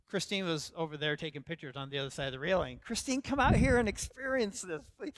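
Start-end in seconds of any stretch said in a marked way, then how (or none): 2.8-5.1 calling out. Retold or imitated. Calling wife out to the edge.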